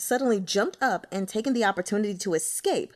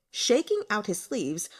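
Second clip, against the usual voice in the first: ordinary delivery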